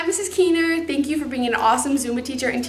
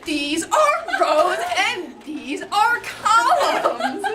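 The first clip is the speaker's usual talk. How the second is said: imitating teacher; 7 semitones higher, 3 dB louder, much slower than usual. Quoting teacher, laughing.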